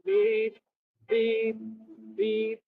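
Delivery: high repeated beeps mimicking a car alarm; copying a car alarm at a passer-by; car alarm imitation. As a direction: beep it out like a car alarm going off. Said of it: "That's a car alarm".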